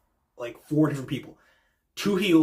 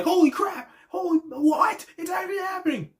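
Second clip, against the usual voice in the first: much higher, shouted, disbelieving joy; excited fans. Retold or imitated. Fans reacting to the surprise cash-in, shouting in joy. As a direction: yell it like fans who can't believe it. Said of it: "them being like".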